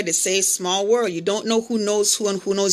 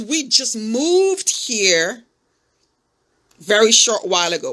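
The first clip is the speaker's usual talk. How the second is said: evasive drawl. Retold or imitated. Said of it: "Wouldn't give a time frame".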